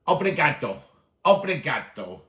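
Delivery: "obrigado" carefully said as ob-ri-ga-do; a learner practising "obrigado"; Portuguese word. Shown as a demonstration. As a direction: try out "obrigado" with a Portuguese sound. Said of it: "I need to learn some of your home language".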